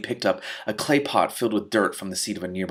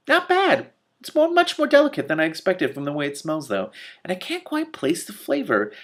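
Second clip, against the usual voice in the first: lightly voiced donkey; 8 semitones higher than usual. Donkey Killer's voice.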